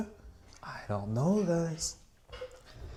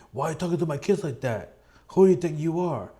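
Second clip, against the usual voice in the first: mock angry parent; 5 semitones higher, 4 dB louder, much faster than usual. Parent confronts stranger over kids.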